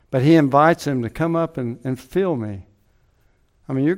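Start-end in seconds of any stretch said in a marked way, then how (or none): none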